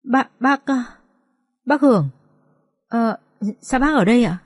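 taken aback, faltering; stunned stammer. Acted. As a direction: stammer in shock, pausing between words. Stunned girl meets feared man.

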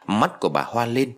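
ordinary delivery, nothing marked

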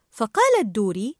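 plain reading voice

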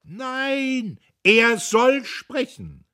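loud, high, slow shout from the crowd; a voice from the hall insists; slow, high, loud demand; heckler shouting. A narrator's reading.

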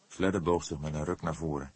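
ordinary delivery, nothing marked

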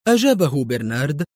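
usual speaking voice

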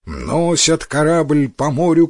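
usual speaking voice

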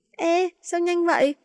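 high, drawn-out letdown cry; disappointed protest. Acted. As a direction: protest that it ended too soon. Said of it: "Mấy đứa bực bội".

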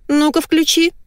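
high-pitched order; commanding wife. A narrator's reading. Wife ordering husband to switch on TV, commanding. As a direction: order him in a high wifely voice. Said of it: "приказала вторая половина".

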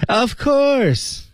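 high, playful "Of course"; teasing answer. Pretend. Friend teasing a friend, playful high reply. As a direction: fake a sweet yes before the twist. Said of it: "你，你真的喜欢我吗".

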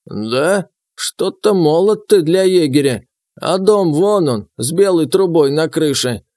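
put-on high, creaky, slow old voice; creaky old man. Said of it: "проскрипел дед".

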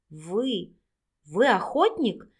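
halting, slow, dread-filled question; horrified. A narrator's reading. Vika asks if he is a hunter, in horror. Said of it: "с ужасом спросила Вика".

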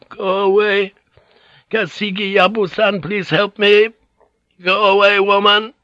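high, frail but insistent; frail old lady. A narrator's reading. Old lady dismisses maid, asks Yabu's help, frail and high. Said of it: "her voice hoarse and frail".

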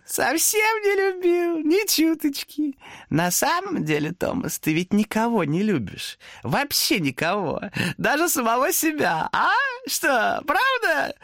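high, slow smirking demon voice. Acted. Demon smirks, denying Thomas's love. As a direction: stretch it out with a sneer.

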